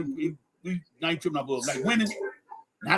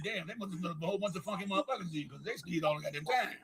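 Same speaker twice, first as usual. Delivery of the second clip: muttered thought; 12 dB quieter than usual. Retold or imitated. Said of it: "And I thought about this shit".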